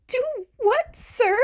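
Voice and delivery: very high, loud nervous yelp; startled yelp. Acted. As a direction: yelp as he's cornered. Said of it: "Izuku yelped".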